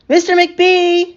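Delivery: high, slow drawn-out call. A narrator's reading. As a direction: call down the hall, stretched long. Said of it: "she called after a minute".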